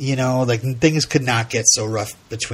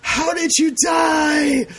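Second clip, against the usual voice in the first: much higher, slow hammed-up outcry; mock dramatic cry; slower than usual. Host quoting Patty to the ghost, hammed-up cry. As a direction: overplay the mother's anguished question. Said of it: "Patty says".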